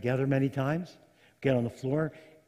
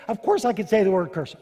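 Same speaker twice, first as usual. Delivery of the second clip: loud, higher defensive comeback; indignant retort; 4 semitones higher, 8 dB louder than usual. Acted. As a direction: snap back loudly, insulted at the question. Balaam indignantly answers Balak back.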